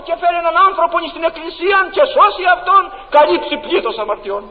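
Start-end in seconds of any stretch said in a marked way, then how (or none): none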